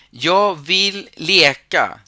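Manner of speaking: "Yo vill leka" slow, with stress on "yo"; slow emphatic example. Shown as a demonstration. Teacher contrasting Swedish and Spanish for students, emphatic. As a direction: lay it out slowly and firmly. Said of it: "teacher stressing model sentence".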